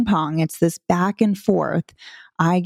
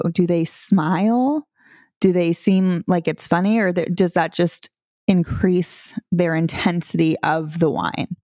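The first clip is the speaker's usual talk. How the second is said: ordinary talk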